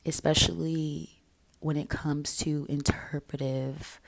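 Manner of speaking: normal talking voice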